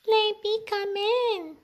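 very high little mouse voice; teeny tiny voice. Acted. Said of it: "in a teeny tiny voice".

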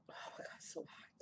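fast, pained whisper; gasping it out under the breath from the burn. Real, not acted. Mouth burning from spicy noodles.

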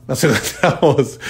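laughing through the words, slow. Real, not acted. Host signing off to listeners, laughing mid-word. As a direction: let a laugh break the phrase.

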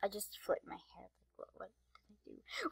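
quick unvoiced whisper aside. Real, not acted. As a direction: whisper it like a secret. Whispered aside about hair.

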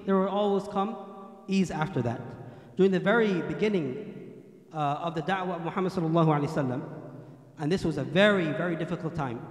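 normal talking voice

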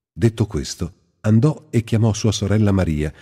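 ordinary delivery

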